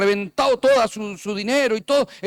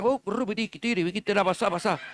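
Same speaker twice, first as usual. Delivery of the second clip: softer, rapid-sounding nonsense syllables in mock tongues; imitando hablar en lenguas; 4 dB quieter than usual. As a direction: babble playfully as if praying in tongues. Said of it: "está hablando en lengua". (balbuceo imitado de lenguas, en broma)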